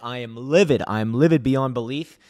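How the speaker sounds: loud, furious venting to the camera; real anger at the start of the stream; angry rant. Real, not acted. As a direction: let the fury spill out, loud. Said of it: "I'm mad".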